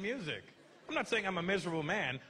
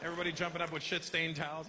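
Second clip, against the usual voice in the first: ordinary talk, nothing marked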